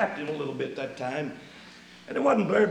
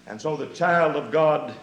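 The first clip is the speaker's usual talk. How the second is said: ordinary talk, nothing marked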